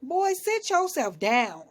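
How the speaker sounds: slow and loud, a big-sister scold; sit them down like a mock-stern auntie. Mock scolding the show cast.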